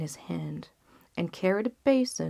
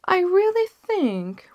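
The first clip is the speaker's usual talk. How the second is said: much higher, louder, slow royal verdict; king; 13 semitones higher, 8 dB louder, slower than usual. Acted. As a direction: weigh it aloud like a king. King decides.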